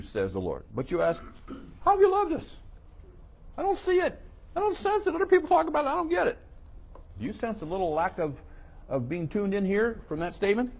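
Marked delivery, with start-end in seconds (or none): 1.8-6.4 high, louder whiny protest; mock indignant complainer. Preacher mimics Israel doubting God's love, whiny mock protest. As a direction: squeak out a petulant complaint. Mocking the people questioning God.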